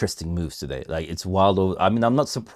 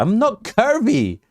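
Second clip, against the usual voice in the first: mock protest; 14 semitones higher, 5 dB louder, slower than usual. Denies chat's curvy claim.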